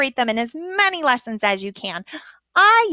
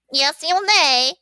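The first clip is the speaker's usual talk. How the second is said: teacher voice; 3 semitones higher, 4 dB louder than usual. Shown as a demonstration. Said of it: "I use her to explain that".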